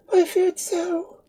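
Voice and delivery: slow, a touch of sighing sadness; mild sigh. Acted. Pauline hearing her hero is wounded, slow mild sigh. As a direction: sigh it out, worried for him. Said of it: "sighed Pauline".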